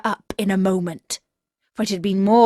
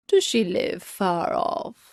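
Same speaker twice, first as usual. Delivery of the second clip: wolf voice. Acted.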